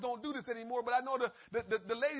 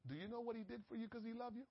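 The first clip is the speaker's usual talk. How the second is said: soft, fast, slipped-in question; sneaky quick aside; 12 dB quieter, faster than usual. Retold or imitated. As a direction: sidle up and ask it under your breath, quickly. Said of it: "Then sneak back up".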